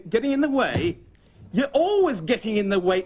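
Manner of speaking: ordinary talk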